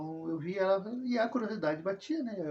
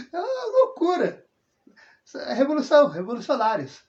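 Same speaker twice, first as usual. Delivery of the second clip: much higher and louder, bursting with amused excitement; excited exclamation; 7 semitones higher, 10 dB louder than usual. Real, not acted. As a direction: exclaim it like a wild scandal, half laughing. Laughing disbelief at parents' romance.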